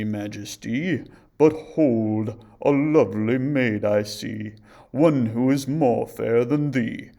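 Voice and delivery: loud, slow, ominous revelation; magic mirror's voice. A narrator's reading. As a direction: deliver bad news as an enchanted mirror. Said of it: "it replied with the word".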